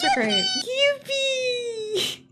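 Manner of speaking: very high squeal of delight; a real high-pitched cheer; squealing joy. Real, not acted. Gushing over the dust bunnies.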